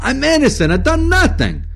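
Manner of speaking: very high, loud wail of innocence; shrieked denial; hysterical protest. Acted. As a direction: cry out your innocence desperately. Eccles wails his innocence.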